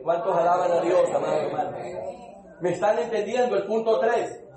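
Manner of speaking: usual speaking voice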